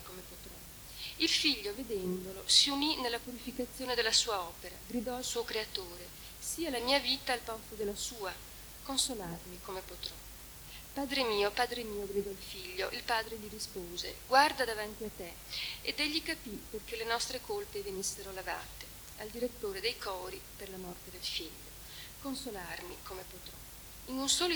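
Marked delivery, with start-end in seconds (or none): 1.2-21.7 a mournful recitation of the Trento lament; solemn reading of a lament. Acted. Reads the lament translation aloud. 22.2-23.5 "Consolarmi come potrò" in a soft, unvoiced whisper; a hushed, grieving whisper of "Consolarmi come potrò"; whispered refrain.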